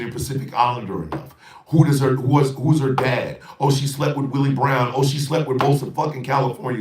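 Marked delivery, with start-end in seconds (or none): 1.7-6.8 real heated outburst, louder and higher; getting worked up, pushing the voice hard; heated rant, louder and higher. Real, not acted. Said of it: "I'm sorry, but I'm getting heated".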